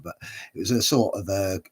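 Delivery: ordinary delivery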